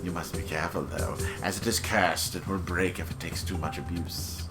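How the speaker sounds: low, suave merchant voice; creepy salesman pitch; sinister shopkeeper. Acted. Reads shopkeeper dialogue.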